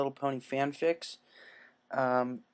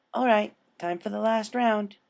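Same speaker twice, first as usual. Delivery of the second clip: higher chirpy pony voice; cheerful Pinkie voice; 8 semitones higher, 4 dB louder than usual. Acted. Pinkie readies the syringe, high and chipper. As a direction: sing it out like a perky nurse.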